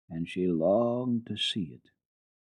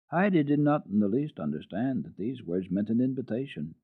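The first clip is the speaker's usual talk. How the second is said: ordinary delivery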